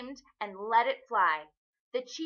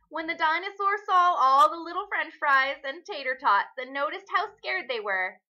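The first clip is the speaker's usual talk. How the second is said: ordinary delivery, nothing marked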